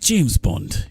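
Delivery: a hint of a deep gruff James Bond; lightly growled the name's Bond line. Streamer quoting Bond in a deep rough voice. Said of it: "that real sort of deep".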